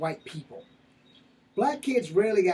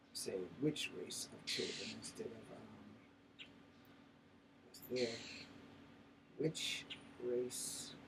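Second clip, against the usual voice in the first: very soft, low, halting self-talk; muttering while typing; 4 semitones lower, 16 dB quieter than usual. Real, not acted. Typing a search into the phone, absent-minded low muttering. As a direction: mumble it word by word as you type.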